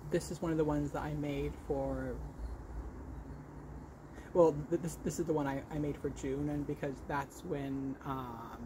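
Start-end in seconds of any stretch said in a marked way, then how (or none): none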